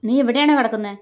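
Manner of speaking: loud, high irate challenge; gritted-teeth anger. A narrator's reading. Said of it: "പല്ലിരുമ്മിക്കൊണ്ട് ചോദിച്ചു". (പല്ലിരുമ്മി ദേഷ്യത്തോടെ)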